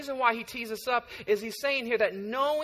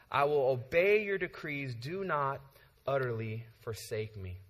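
scripture reading; 4 semitones lower than usual. Acted. Pastor reading the psalm to the congregation, measured. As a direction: read the psalm with reverence. Reads Psalm 119 aloud.

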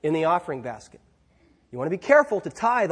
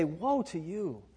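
softer, slow, sorrowful warning to a loved one; grieving warning; 10 dB quieter, slower than usual. Pretend. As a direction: say it gently, with regret and grief. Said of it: "spoken out of a heart that cares".

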